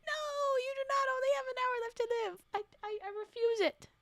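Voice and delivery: high-pitched, hammed-up wail of refusal; mock wailing protest. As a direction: protest in pretend tearful denial. Mock-horrified refusal at the story.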